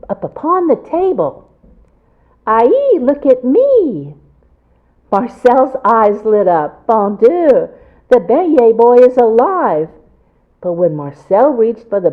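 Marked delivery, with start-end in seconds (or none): 2.5-4.1 put-on high, loud, delighted little boy; excited child voice. A narrator's reading. Beignet boy comes alive. 6.9-9.9 amazed. A narrator's reading. Marcel amazed.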